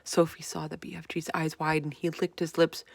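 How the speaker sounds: ordinary delivery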